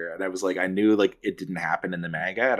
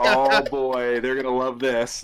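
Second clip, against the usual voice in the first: higher, gleeful put-on voice; knowing glee; 6 semitones higher, slower than usual. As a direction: tease it like you know fans will swoon. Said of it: "I remember watching that episode and be like".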